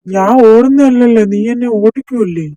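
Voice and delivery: shouted defence while being chased; shouting back. Acted. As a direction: yell it back over your shoulder.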